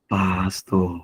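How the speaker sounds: low, drawn-out chiding call; inner voice of reproach. Retold or imitated. Conscience calling the angry pastor to order, low reproachful voice. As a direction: draw the title out low, as a reproach. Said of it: "in my spirit".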